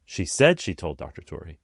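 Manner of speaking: SAID punched, the rest falling away; stress on SAID. Shown as a demonstration. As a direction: hit the word "said". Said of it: "Changing the emphasis changes the entire meaning".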